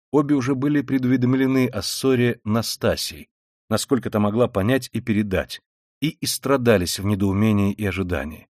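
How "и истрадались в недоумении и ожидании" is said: usual speaking voice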